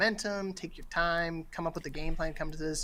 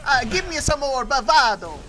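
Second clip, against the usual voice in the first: loud, hammed-up Italian accent, slow on the request; louder, over-the-top Italian accent asking for more bravado; mock Italian director; 5 semitones higher, 12 dB louder than usual. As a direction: play a bad Italian voice director demanding bravado. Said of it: "They, they be like".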